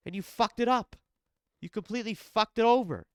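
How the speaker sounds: frustrated scolding. Real, not acted. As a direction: hammer the blame home, fed up. Scolding the fighter who lost.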